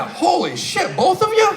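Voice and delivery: high, loud incredulous squeak; rising shock as the second girl speaks up; shocked disbelief. Retold or imitated. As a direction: caught off guard by a double apology. Shocked at having to apologize twice.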